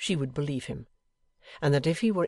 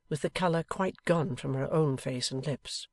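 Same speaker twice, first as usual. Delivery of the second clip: usual speaking voice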